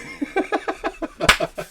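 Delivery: high-pitched giggling laugh. Real, not acted. Host cracks up at his own bad accent, laughing. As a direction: laugh in a high, rapid run.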